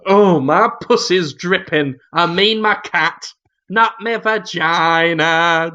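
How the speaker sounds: mock sitcom matron voice; Missus Slocum. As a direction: play a double entendre slowly, hammed-up. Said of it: "Missus Slocum, who would go".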